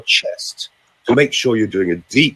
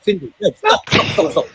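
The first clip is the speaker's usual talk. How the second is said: urgent stopping. Real, not acted. Stopping the wrong exercise.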